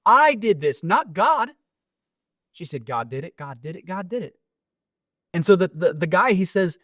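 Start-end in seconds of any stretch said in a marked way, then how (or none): 0.0-1.5 indignant atheist neighbor. Retold or imitated. Atheist neighbor lists, higher. 2.9-4.3 softer, calm repeated faith; soft stubborn insistence. Retold or imitated. Old woman quietly insisting.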